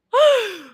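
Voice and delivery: a loud, high gasp; sharp shocked gasp. Real, not acted. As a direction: gasp in sudden alarm. Reacting to her own burp.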